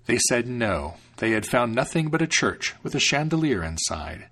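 ordinary delivery, nothing marked

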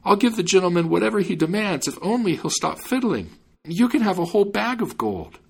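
high, urgent pleading; crying out. A narrator's reading. Man offers gold to stop the fiddling, crying out. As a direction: plead with pitch held high.

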